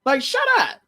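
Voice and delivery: high-pitched mock exasperation; exasperated outburst. Vlogger fed up with a rapper's talking, high exasperated outburst. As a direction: squeal the dismissal, hammed-up.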